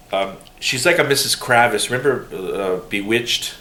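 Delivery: ordinary talk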